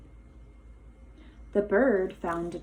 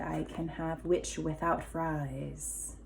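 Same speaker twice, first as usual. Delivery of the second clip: quiet dragon aside; 3 semitones lower, 8 dB quieter than usual. Acted. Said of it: "And there he said".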